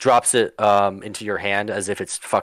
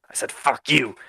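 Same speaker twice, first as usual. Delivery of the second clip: higher, emphatic acted retort; defiant repeat; 4 semitones higher than usual. Craig turning round to the dwarf who challenged him, defiant repeat. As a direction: repeat it clearly, right at him. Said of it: "What'd you say?".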